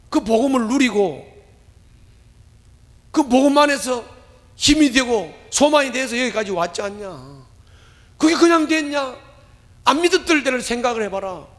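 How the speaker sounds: rising, emphatic sermon build; fervent pulpit delivery; preaching. Acted.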